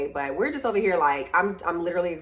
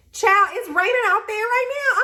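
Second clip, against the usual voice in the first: exasperated; 13 semitones higher, 3 dB louder than usual. Real, not acted. Exasperated aside about the rain.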